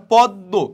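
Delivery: পদ্ম loud, as pod-do; doubled consonant. Shown as a demonstration. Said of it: "হচ্ছে না দ্বিত্ব".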